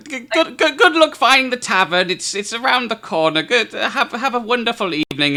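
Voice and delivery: put-on high, flustered old lady voice; Esme hurrying goodbye. Esme shooing the guest out of her house, high fluster. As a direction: fuss them out the door, chattering.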